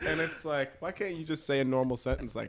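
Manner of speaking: ordinary delivery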